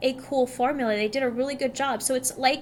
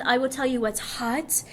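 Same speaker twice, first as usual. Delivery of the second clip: sexy voice. Pretend. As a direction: say it like a seductive promo. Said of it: "You like how I have to do this sexy voice?".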